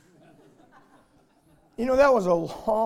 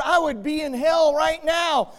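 louder and higher, hammered out; preaching shout; 8 semitones higher, 6 dB louder than usual. Acted. Preacher warns the congregation they deserve hell, shouting. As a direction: cry it out to the whole church.

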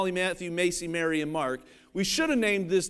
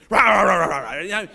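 dog devouring growl; 3 semitones lower, 6 dB louder than usual. Pretend. Dog tearing into his food.

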